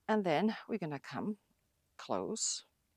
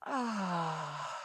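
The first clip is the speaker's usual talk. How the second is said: dramatic sigh. Shown as a demonstration. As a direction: open breathy "ah" release. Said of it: "make a big sigh".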